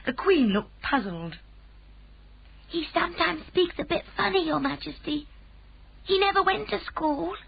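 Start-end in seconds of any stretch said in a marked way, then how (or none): 2.7-7.4 put-on high, earnest child's voice; a small girl excusing her friend; little girl Sophie. A narrator's reading. Sophie explains.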